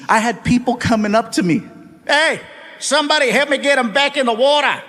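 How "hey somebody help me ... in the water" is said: higher-pitched Aussie calling out; mock Australian accent. Australian beachgoer to others about the comedian, mock Australian accent. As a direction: call for help as an Australian beachgoer.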